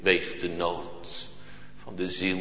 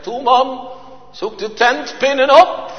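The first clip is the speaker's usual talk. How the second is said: high, loud, pleading wife's call; urgent wife; 12 dB louder than usual. Retold or imitated. Wife urging her husband to find the tent pegs, high and loud. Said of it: "Rebecca zegt".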